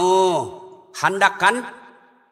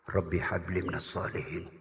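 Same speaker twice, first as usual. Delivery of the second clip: much lower and far softer, breathed into the ear; whisper in the ear; 13 dB quieter than usual. Retold or imitated. Uncle whispers the prayer into his nephew's ear to call him home. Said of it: "Saya bisik telinganya".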